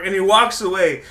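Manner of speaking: normal talking voice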